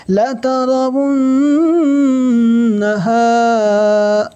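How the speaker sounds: slow, high melodic tajweed chant; slow sung Quran recitation; Quranic recitation. Shown as a demonstration. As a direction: draw out each word in recitation.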